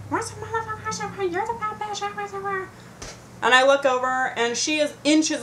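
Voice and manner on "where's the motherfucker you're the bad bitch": high, held-back imitation of yelling; mock yell. Storyteller mimics the woman cursing from the van, high hushed mock yell. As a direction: mimic distant shouting in a soft, squealy-high voice. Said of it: "I hear very loudly".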